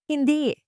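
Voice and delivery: a firm, emphatic no, pitched high; sharp, insistent Hindi; firm denial. A narrator's reading. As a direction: cut her off with a firm Hindi. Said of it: "mariing sabi ni Aling Lourdes".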